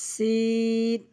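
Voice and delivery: সিন with long hissed s, long vowel; airy drawn-out sin. Shown as a demonstration. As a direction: let air out lightly, then stretch সিন. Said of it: "আগে হালকা করে বাতাস বের করে". (হালকা বাতাস ছেড়ে টেনে সিন)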